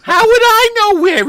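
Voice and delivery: high, loud Yiddish grandmother complaint; Yiddish accent. Acted. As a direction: whine it like a put-upon bubbe.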